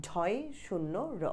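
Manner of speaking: slow dictation pace; slow and clear. Shown as a demonstration. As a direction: spell ঢয় শূন্য র slowly. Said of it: "ডয় শূন্য র আছে".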